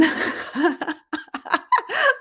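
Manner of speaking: high burst of giggles; amused laugh. Real, not acted. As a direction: crack up at your own bad accent. Cracks up at own accent.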